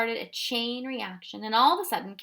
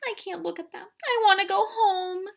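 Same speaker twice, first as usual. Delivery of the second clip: higher, rising to a louder plea; a scared child begging to leave; frightened cry; 11 semitones higher, 5 dB louder than usual. A narrator's reading. Lindy begging the professor, a frightened cry. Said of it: "she cried".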